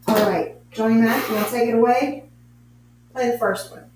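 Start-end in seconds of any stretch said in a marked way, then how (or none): none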